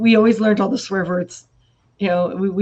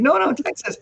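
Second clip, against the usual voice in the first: higher mock protest; retold protest; 7 semitones higher than usual. Teased Texan friend insisting on her origins, imitated higher protest. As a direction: act out her insistent denial. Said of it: "And she's like".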